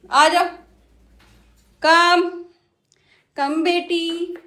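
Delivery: slow, high, cooing coax. Real, not acted. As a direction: lure the pet onto the cushion lovingly. Coaxing the parrot lovingly.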